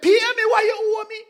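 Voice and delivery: high, put-on Jamaican demand; Jamaican accent. Servant demanding his money back, in a Jamaican accent. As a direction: say it like an angry Jamaican creditor. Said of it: "A Jamaican".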